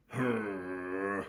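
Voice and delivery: long, loud grumpy "hmm"; drawn-out grumbling hum sinking down; Jonah Jameson grumble. Acted. Teacher imitates grumpy editor's initial grumble. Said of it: "The way that he does that initial grumble".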